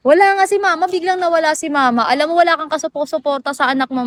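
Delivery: usual speaking voice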